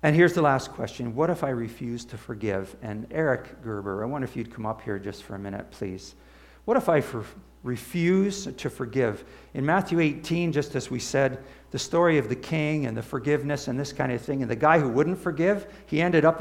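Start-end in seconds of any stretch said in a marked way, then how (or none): none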